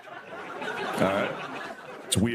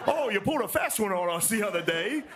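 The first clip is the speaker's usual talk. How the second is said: high-pitched mocking imitation; mock soldier banter; 10 semitones higher than usual. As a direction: parody quaint soldier talk, pitched way up. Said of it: "that's how they talk".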